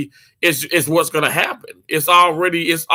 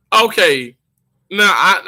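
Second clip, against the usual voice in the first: normal talking voice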